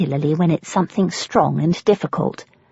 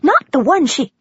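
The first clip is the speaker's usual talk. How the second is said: put-on higher, sharp-edged voice of a hostile old woman; sharp thin voice; 8 semitones higher, 4 dB louder than usual. A narrator's reading. Old woman to newcomer, a sharp thin voice. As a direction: cut her off sharply and thinly. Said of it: "Her voice is sharp and thin".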